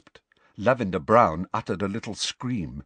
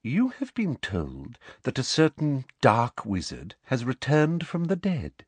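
raised, measured lecture voice; prim, condescending professor; 6 semitones higher than usual. A narrator's reading. Professor telling the class of the rumour, condescending. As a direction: address them primly, pitched up.

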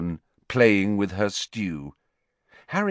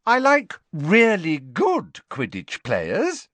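high, slow and pointed, with a smile; smiling correction; 9 semitones higher, 5 dB louder, slower than usual. A narrator's reading. Hermione correcting Parvati, smiling and drawing it out. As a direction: stretch out the correction, loud and sweet. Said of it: "still smiling".

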